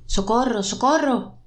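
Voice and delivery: a restrained cry of distress; calling for help. A narrator's reading. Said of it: "una nueva voz está llamando dentro de mí". (llamada de auxilio, súplica contenida)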